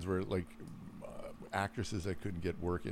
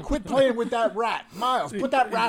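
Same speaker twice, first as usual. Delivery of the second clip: high, loud laughing scold; scolding parent; 14 semitones higher, 8 dB louder than usual. Pretend. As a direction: yell at the kid through laughter.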